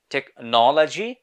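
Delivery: British "টেকনোলজি", rounded NOL, rising end; British accent, drawn out for contrast; British pronunciation. Shown as a demonstration. Teacher contrasts two accents, British first. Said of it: "British people এটাকে উচ্চারণ করে থাকে".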